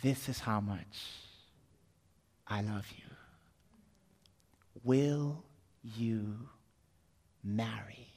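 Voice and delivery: soft, low, slow declaration of love; tender proposal. Acted. Jesus proposing to his beloved from the cross, tender. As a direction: propose gently, nearly whispering at the end.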